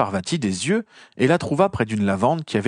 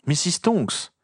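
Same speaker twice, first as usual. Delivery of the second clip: exclaiming; 6 semitones higher, slower than usual. A narrator's reading. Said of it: "s'écria-t-il".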